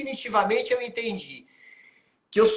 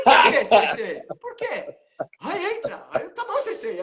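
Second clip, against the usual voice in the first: high voice breaking into laughter; laughing while quoting; 6 semitones higher, 6 dB louder than usual. Retold or imitated. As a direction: quote the exchange through laughter. Laughing retelling the dialogue. (rindo enquanto imita o diálogo)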